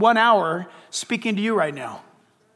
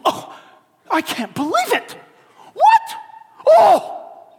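hammed-up gasping shock, much higher and louder; mock shocked reaction; 11 semitones higher, 6 dB louder, slower than usual. Preacher mocks the wrong way to read the sin list, hammed-up shock. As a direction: play the scandalised reader, over the top. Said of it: "Do you go like this?".

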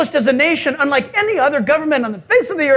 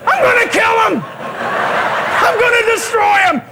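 high, furious mock shout; hammed-up wrathful yell; God; 8 semitones higher, 3 dB louder, slower than usual. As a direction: holler as if about to wipe them all out. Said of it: "And God says".

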